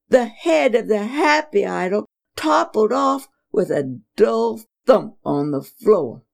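ordinary delivery

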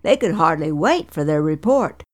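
ordinary delivery

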